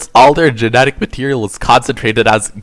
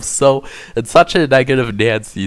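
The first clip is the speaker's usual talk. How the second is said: usual speaking voice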